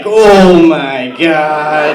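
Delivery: very slow, loud drawn-out oh my God; shocked gasp. Acted. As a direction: stretch it out in horror. Shocked mom at knife scene.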